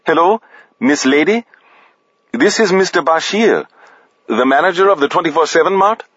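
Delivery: high-pitched, courteous manager on the phone; Bashir, polite store manager. Acted. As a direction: introduce yourself politely in a high voice.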